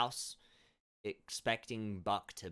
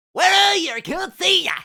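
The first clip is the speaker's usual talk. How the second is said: much higher and louder calling from the crops; old farmer calling out; 18 dB louder than usual. Acted. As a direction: shout to find people you cannot see. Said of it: "He's like".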